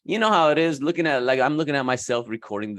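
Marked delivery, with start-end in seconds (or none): none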